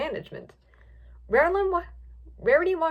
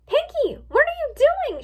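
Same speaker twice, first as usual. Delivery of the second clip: very high, loud cry; shocked shout; 6 dB louder than usual. A narrator's reading. Twilight seeing Pinkie eat a duck, shocked shout. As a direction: shout in horrified disbelief. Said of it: "she shouted".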